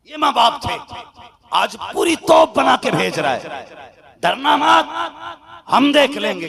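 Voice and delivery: rising, loud preacher's cry; fiery preaching. Acted. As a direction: build the sermon to a shout. Preacher rising to full force. (जोशीला बयान, ऊँची आवाज़ में)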